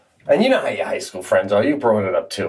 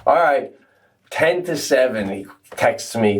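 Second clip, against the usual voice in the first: ordinary talk, nothing marked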